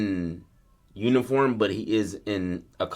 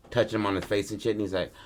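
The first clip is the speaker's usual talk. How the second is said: usual speaking voice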